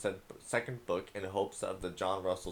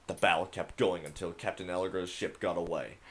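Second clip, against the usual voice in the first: epic voice; 3 semitones higher, 11 dB louder than usual. Pretend. Gamer reads a child's pirate story aloud, loud epic voice. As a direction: boom it out like a grand pirate saga. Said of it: "do a little epic voice".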